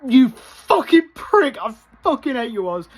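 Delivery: reliving yelling at Oz on screen, high furious shout. Retold or imitated. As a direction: scream abuse at the villain. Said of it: "I also started going".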